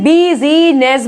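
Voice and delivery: बिजीनेस pulled out as bee-zee-ness; wrong sounded i. Shown as a demonstration. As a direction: give बिजीनेस three separate syllables. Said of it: "बोलेंगे नहीं".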